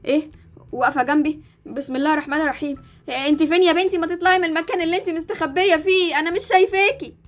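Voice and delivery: startled mother, muttering a prayer fast; a mother spooked by an invisible daughter. Acted. Mother scared, can't see her. (أم مخضوضة بتقرا بسم الله بسرعة)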